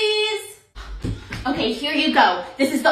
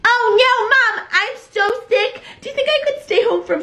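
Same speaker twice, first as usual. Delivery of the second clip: fake-sick kid; 6 semitones higher, 3 dB louder than usual. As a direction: ham up being sick. Kid faking sickness.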